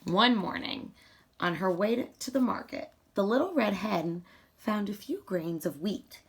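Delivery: ordinary delivery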